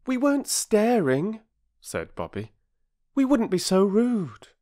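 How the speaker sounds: a high, prim schoolgirl voice; politely offended young lady; indignant girl. A narrator's reading. Bobby answering the bargee, prim and high. Girl's voice.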